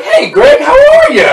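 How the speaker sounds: telling security how he greeted Greg, in a very high, fake-cheerful voice; fake cheery greeting. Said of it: "and I was like".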